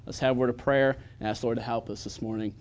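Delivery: ordinary talk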